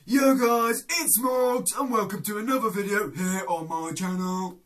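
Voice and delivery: loud, high YouTuber shout; impression of Morgs. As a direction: yell the intro like a hyped YouTuber. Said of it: "The first one is YouTuber Morgs".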